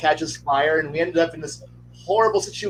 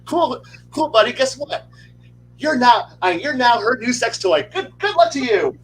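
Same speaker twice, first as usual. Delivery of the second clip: higher-pitched, breezy retold send-off; mock cheerful; 7 semitones higher than usual. Party tells the wizard he is sold off, mock cheerful. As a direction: break it to your buddy with mock cheer, pitched up.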